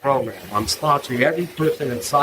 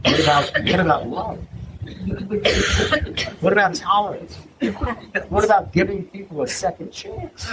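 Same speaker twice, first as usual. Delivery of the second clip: higher-pitched mock earnest appeal; imitated plea; 5 semitones higher than usual. Friend appealing to Russell for tolerance, mock pleading. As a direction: plead like an indignant idealist, played for laughs.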